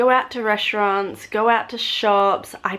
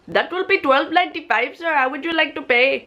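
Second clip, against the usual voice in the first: higher, imitated Indian-accented cashier; 7 semitones higher than usual. Cashier asking a customer how to pay, imitated Indian accent. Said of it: "Here's an example. / copy their accent".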